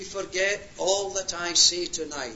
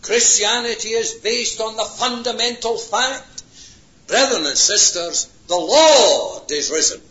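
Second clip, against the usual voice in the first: loud, rising proclamation from the pulpit; ringing pulpit cry of faith; full-force preaching; 6 semitones higher, 8 dB louder than usual. Real, not acted. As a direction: declare it to the whole congregation.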